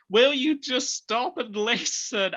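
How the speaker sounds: slow down in mock impatience, pitch lifted; exasperated. Podcaster as the Doctor scolding Adric, mildly exasperated.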